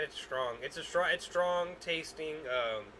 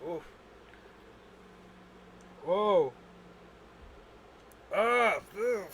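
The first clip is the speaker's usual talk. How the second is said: ordinary delivery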